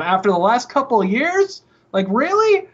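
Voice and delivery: high-pitched disbelief; incredulous. Real, not acted. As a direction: scoff in sharp disbelief. Ranting at Microsoft's ego.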